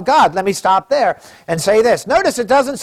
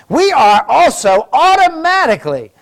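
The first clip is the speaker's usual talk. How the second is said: automatically lifted higher and set apart; automatically pitched up with emphasis; stress on automatically; 5 semitones higher than usual. Shown as a demonstration. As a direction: hit the word automatically hard. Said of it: "I emphasize the word automatically".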